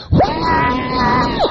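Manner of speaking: very high, loud ecstatic whoa; joyful shout. Retold or imitated. Hewlin shouting in the car, a huge ecstatic whoa. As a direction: holler it like you're overcome. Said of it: "He said".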